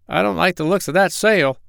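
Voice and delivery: high-pitched worried storybook voice; wary Dab-Dab. Acted.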